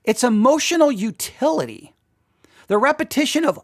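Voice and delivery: ordinary talk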